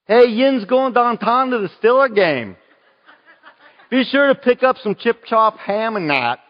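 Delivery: put-on high, loud, broad Pittsburgh accent; playful Pittsburgh accent, high and loud. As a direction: do your best Yinzer Pittsburgh accent, laughing at the end. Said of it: "we have a Pittsburgh accent".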